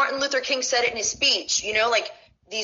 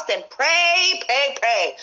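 impassioned, insistent "pray, pray, pray"; emphatic exhortation; 2 semitones higher, 3 dB louder, much slower than usual. Real, not acted. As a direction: hammer the word "pray" three times, the first highest. Heated exhortation about religious freedom.

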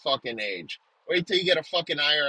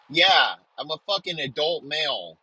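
ordinary talk, nothing marked

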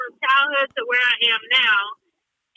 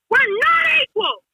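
mock Klansman shouting close up; Klansman's shout; 10 semitones higher than usual. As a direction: get in her face and yell it. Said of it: "like, in my fucking face".

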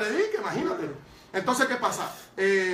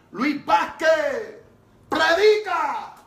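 slow, loud, higher hoarse command with each word drawn out; hoarse commanding evangelist; 8 semitones higher, 7 dB louder, much slower than usual. Retold or imitated. Evangelist ordering young Luis to preach, slow hoarse command. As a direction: boom it out like a hoarse old preacher full of authority. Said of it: "el hombre habla bien ronco".

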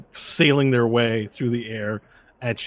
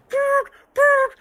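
very high imitated whistle blasts, louder; 5 dB louder than usual. Official blows his whistle at the fighter, high imitated toots. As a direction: chirp it out like a referee's whistle. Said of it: "takes the whistle and goes".

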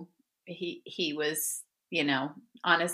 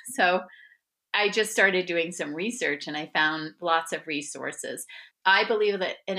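normal talking voice

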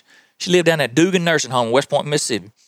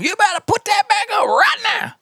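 grandma hollering. Retold or imitated. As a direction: yell it from the screen door like a tough old lady. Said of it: "She hollered at 'em".

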